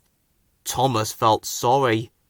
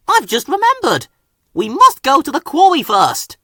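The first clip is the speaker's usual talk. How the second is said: higher, louder flustered engine; hasty excuse; 13 semitones higher, 7 dB louder than usual. A narrator's reading. Thomas inventing a reason to leave, flustered. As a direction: cover it up fast.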